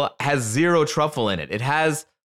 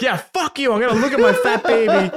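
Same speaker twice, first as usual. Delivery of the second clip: higher, fast and laughing, cheeky brush-off; mock defiant Mark; 9 semitones higher, 5 dB louder than usual. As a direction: toss it off mockingly through a laugh. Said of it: "And then Mark's like".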